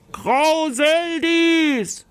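high, long-drawn challenge call. A narrator's reading. Knight summoning the giantess in her castle, calling out. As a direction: call the giantess out, slowly stretching the name. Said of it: "rief sie".

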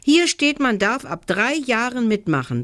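put-on high-pitched, insistent little boy. A narrator's reading. Little brother argues with his older brother about taking part, insistent. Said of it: "beharrte Jeremias".

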